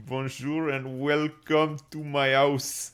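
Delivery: a hammed-up French accent; mock French greeting. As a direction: put on a hammy French host voice. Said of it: "bust one of those out".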